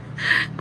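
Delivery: brief self-amused chuckle; quick soft laugh at yourself. Real, not acted. Laughs after praising own English.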